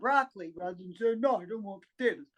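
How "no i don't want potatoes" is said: fast, heavy no to potatoes; heavy refusal. Shown as a demonstration. Speaker showing how heavy a plain refusal sounds. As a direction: drop it like a weight. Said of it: "It sounds like nothing".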